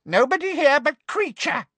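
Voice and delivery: high gleeful house-elf squeak. Acted. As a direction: gloat like a sly elf. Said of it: "said the elf gleefully".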